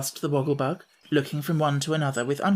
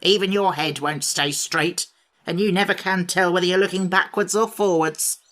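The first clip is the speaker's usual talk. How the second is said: louder mocking sneer; 4 semitones higher, 3 dB louder than usual. A narrator's reading. Sawhorse mocking the Pumpkinhead's build, sneering. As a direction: look down on him. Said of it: "sneered the horse".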